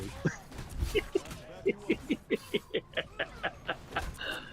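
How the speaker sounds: silent breathy wheeze of a laugh; wheezing laugh. Real, not acted. Host wheezing with laughter at the memory, unvoiced laugh.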